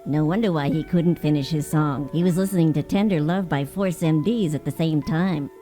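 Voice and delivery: ordinary delivery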